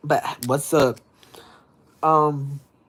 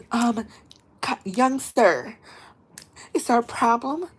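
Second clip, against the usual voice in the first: stern host lady; 10 semitones higher than usual. Retold or imitated. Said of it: "and she was like".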